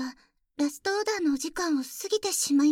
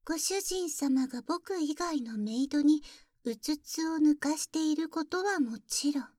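ordinary talk, nothing marked

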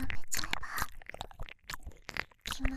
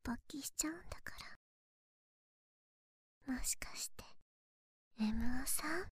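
right in your ear; 4 dB quieter than usual. Acted. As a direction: close ear whisper. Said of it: "耳元でささやいたら".

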